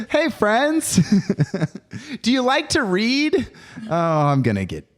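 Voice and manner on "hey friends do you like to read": high, slow, sing-song cheer voice; Tracy Morgan cheerleader. Retold or imitated. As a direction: chirp it like a peppy cheerleader. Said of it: "cheerleader Tracy Morgan from The Longest Yard".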